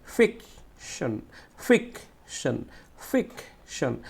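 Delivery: "Fiction" slowly, "ফিক" emphasized and set apart from "শন"; stress on first syllable. Shown as a demonstration. Teacher demonstrating stress to pupils, "fiction" slowly stressed on "ফিক". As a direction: hit "ফিক", pause, then release "শন". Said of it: "আমাকে জোরে উচ্চারণ করতে হবে".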